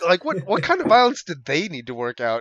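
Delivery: loud, high disbelief; incredulous. Retold or imitated. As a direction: demand what happened, amazed. Retold incredulous question.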